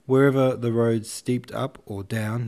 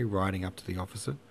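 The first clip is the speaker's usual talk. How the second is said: plain reading voice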